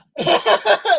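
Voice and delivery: another high, loud laughing burst. Real, not acted. Streamer still cracking up, loud laugh.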